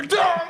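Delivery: high sharp sneeze of the name; name sneezed. Pretend. Sneezing the name from pollen. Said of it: "So much pollen in the air".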